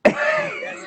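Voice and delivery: sudden high excited yes; excited yelp. Real, not acted. Host to guest, revealing a fact with an excited high yelp.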